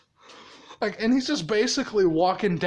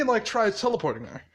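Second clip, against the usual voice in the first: ordinary delivery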